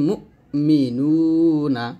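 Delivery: মুমিনুনা recited very slowly with a firm hamza; slow recitation. Shown as a demonstration. Teacher recites the word slowly as a model. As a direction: chant it drawn out and firm. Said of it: "বলতে হবে".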